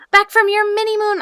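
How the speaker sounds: excited greeting. Real, not acted. Greeting Rach back from her mini moon, squealing. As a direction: welcome a friend back with a delighted squeal. Greeting a returning chat friend.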